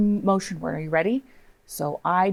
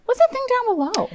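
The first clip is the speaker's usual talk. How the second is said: much higher, wondering question; curious high; 4 dB louder than usual. Acted. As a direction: ask like an amazed child.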